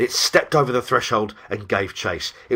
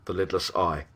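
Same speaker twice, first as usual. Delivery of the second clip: ordinary delivery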